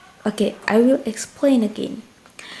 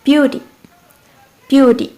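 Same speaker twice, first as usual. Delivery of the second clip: "beauty" with BEAU higher and louder; stress on first syllable; 3 semitones higher, 5 dB louder than usual. Shown as a demonstration. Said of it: "way to read it is the same".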